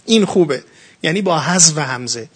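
normal talking voice

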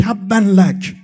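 loud, high-pitched outburst acting the Prophet's opponent; retold angry shout. Opponent cursing the Prophet as he begins preaching, shouted. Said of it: "کی داد زد و فریاد زد علیه پیامبر".

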